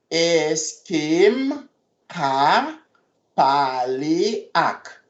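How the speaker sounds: Est-ce que m ka pale ak said slowly in two parts; slow it down for the class; slow and careful. Shown as a demonstration.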